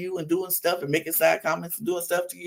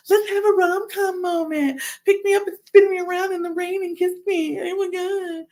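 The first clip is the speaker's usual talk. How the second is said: high-pitched rom-com swooning; hammed-up, high romantic squeal; mock gushing; 12 semitones higher than usual. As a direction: imitate a girl gushing for an audience. Mocks her staged rom-com gushing.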